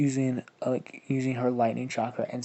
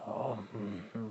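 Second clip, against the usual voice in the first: softer, lower, odd creature humming; silly creature chuckle; 3 semitones lower, 12 dB quieter than usual. Acted. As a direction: hum like a goofy alien amused. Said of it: "That sounds like fucking Jar Jar Beaks".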